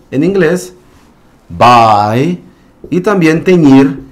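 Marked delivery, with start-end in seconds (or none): 1.5-2.4 bye said low and firm with a long 'ai'; long I sound. Shown as a demonstration. Teacher giving an example word in English, long I sound. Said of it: "sonará como un long I sound".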